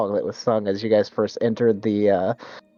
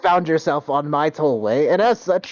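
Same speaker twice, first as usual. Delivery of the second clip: higher, puzzled then demanding brigand voice; confused brigand; 6 semitones higher than usual. Acted. Brigand answers a baffling remark, puzzled then insistent. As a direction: shrug off the joke and demand payment. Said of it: "looks at you confused and goes".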